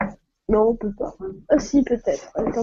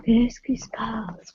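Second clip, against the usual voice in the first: whisper; 7 dB quieter, much slower than usual. Real, not acted. Confused by broken live.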